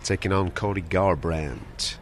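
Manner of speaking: a hint of an American drawl, slowed right down. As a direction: slip into a slow American fight-announcer drawl. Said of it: "Don't know why I did it in that accent".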